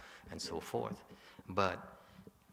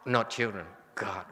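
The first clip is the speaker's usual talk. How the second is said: usual speaking voice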